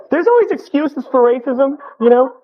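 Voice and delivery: ordinary talk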